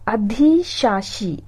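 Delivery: अधिशासी stretched very slowly; drawn-out word. Shown as a demonstration. Teacher stretches अधिशासी out slowly for students.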